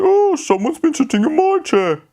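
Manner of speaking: high Mummy Bear voice. Acted. Mummy Bear's line.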